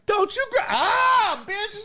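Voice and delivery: high, sharp mock outburst; mock angry lady. Voices the lady lashing out during the fight, high mock yell. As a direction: cut yourself off mid-yell. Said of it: "that lady was like".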